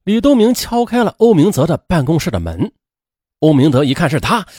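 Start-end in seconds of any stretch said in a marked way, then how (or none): none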